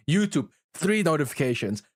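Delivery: usual speaking voice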